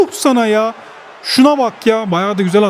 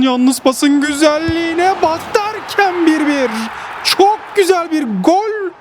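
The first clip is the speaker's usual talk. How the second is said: thrilled goal call; 6 semitones higher, slower than usual. Real, not acted. Thrilled by his assist and goal.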